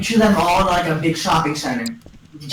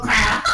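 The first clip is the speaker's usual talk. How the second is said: high-pitched "bam" into giggle; explosion noise. Retold or imitated. Kevin Hart explodes the bazaar, playful high bang. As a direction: burst it out high, then giggle.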